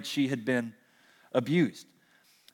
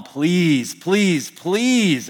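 each please climbing higher, a retold child's plea; mock begging; 5 semitones higher, much slower than usual. Boy nagging his mom all week for the dog, mock pleading. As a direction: beg like a kid nagging his mom, rising every time. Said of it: "I continued to bug my mom".

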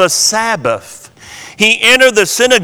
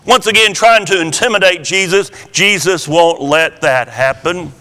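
ordinary delivery

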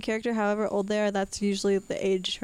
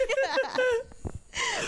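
high squeaky laugh. Real, not acted. Laughing at the joke.

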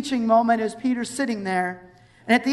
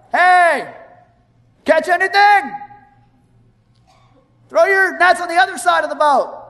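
loud, high holler across the water; calling from shore; 6 semitones higher, 5 dB louder than usual. Acted. Random guy shouts to fishermen from the shore, calling out. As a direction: call out to strangers far off. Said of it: "This random guy off on the shore".

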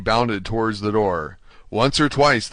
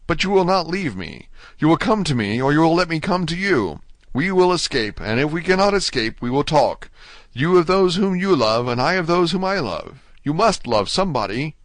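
higher-pitched, hopeful entreaty; eager pleading; 6 semitones higher than usual. A narrator's reading. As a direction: beg the new friend not to leave. Dante begs the unseen prisoner.

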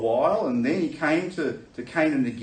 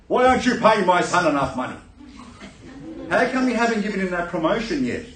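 loud, higher-pitched protest; indignant dad; 7 semitones higher, 6 dB louder than usual. Acted. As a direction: demand it like an outraged father. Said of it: "Dad could come out and say".

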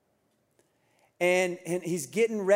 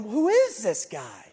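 teary awe; 5 semitones higher, 5 dB louder, slower than usual. Retold or imitated. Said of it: "I'm in the back crying".

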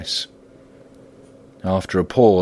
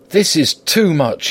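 high, drawn-out indignation; indignant, pitched well up; angry protest; 9 semitones higher, 3 dB louder than usual. A narrator's reading. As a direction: protest angrily, stretching the words. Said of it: "angrily rising again".

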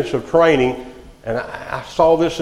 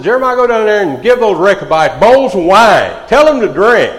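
loud, high command; commanding voice of God; 8 semitones higher, 8 dB louder than usual. Pretend. Preacher voicing God sending Jeremiah, loud and commanding. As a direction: boom the order down to Jeremiah.

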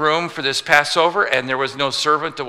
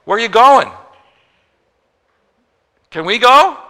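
much higher, louder, pleading disciples; mock eager plea; 9 semitones higher, 9 dB louder than usual. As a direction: beg like eager kids to tag along. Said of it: "You know what they say?".

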